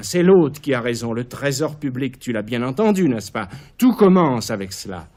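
loud, urgent declaration; fevered outburst. Acted. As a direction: hammer it home to her. Caligula s'emporte devant Késonia. (emportement fiévreux)

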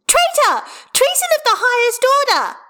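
put-on high, loud, melodramatic child; shrieking accusation. A narrator's reading. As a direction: denounce them at the top of a child's voice. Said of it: "Hestia shrieks".

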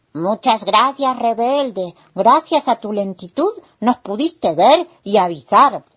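voz de hormiga, aguda y fuerte; da las gracias en voz alta y más aguda; hormigas agradecidas. Acted. (hormiga agradecida, fuerte y aguda)

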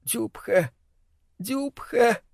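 high, soft, trembling madwoman; trembling drawl. A narrator's reading. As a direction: draw it out, trembling. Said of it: "всё тянула, дрожа".

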